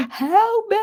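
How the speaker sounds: high-pitched cry for help; scared mock scream. Calling out to the driver after the crash, scared mock scream. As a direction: imitate screaming for help in fright. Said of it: "screaming 'cause I was scared".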